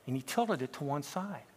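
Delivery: usual speaking voice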